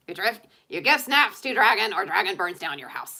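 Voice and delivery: a hint of a bossy cartoon dragon in broken English; lightly voiced dragon making demands. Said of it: "I have a total dragon voice".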